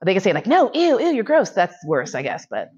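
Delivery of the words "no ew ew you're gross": high-pitched, grossed-out rejection; mock disgust. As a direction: recoil with an exaggerated "ew".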